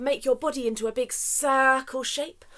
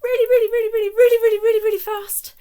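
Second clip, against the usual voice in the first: high, loud, building excitement; high and loud, eager and quick; fast and excited; 12 semitones higher, 11 dB louder, faster than usual. Shown as a demonstration. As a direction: rattle it off like shaking hands fast. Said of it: "shake your hands".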